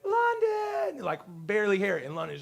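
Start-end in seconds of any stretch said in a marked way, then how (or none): 0.0-1.0 high sustained call; high-pitched, imitated far-off holler; calling from afar. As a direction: call the dog from across a playground. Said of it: "So many kids yelling".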